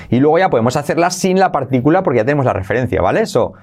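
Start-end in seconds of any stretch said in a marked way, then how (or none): none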